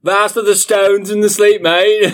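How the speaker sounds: high, loud hammed-up British reading; mock British accent. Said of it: "read in a British accent".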